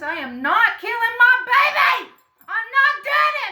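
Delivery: high, full-force shout of refusal; loud acted outburst, stretched and defiant; defiant yell. Retelling her defiant refusal at clinic.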